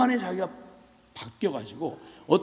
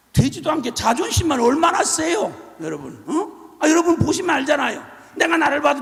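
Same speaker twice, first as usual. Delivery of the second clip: loud scolding; 6 semitones higher, 8 dB louder than usual. Real, not acted. Preacher scolding congregation.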